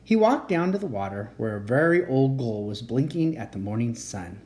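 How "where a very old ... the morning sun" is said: ordinary delivery, nothing marked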